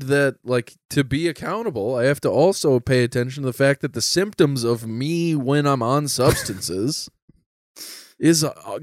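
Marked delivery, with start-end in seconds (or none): none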